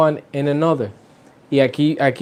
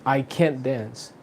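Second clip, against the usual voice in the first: slow, "can't" stressed, softer overall; 7 dB quieter, slower than usual. Shown as a demonstration. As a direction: hit "can't" and let "dance" fall away. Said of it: "pero en el modal verb". (énfasis en "can't", lento y suave)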